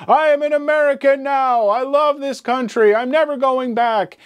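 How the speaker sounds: put-on high, crowing thick Russian accent; proud shout in a heavy Russian accent. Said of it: "in a stereotypically thick Russian accent".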